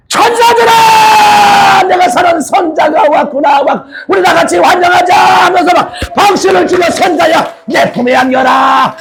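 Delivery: high ringing proclaiming voice of the Lord, shouted; exultant high shouting heavenly welcome; Jesus welcoming. Acted. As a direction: cry out joyfully as Jesus welcoming his bride. Jesus calls Seonja to heaven.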